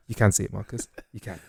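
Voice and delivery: hushed, low retold quote; imitated reassuring look. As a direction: say it very quietly, as if imitating him. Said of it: "he looked at me like".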